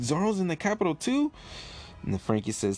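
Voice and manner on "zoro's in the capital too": shocked Usopp. Acted. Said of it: "Usopp's shocked".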